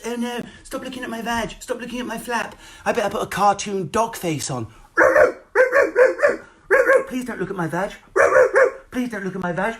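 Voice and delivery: high, simpering fake-shy plea; hammed-up coy pleading; mock coy influencer. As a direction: beg the viewers to stop looking, coyly.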